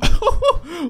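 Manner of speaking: high-pitched chuckle; delighted high laughter; gleeful laugh. Real, not acted. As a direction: let out a high, cocky giggle. Delighted by his own confidence.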